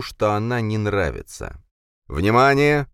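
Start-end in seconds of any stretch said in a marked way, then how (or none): 2.1-2.9 loud, high metallic alarm voice; booming metallic robot. A narrator's reading. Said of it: "металлический голос".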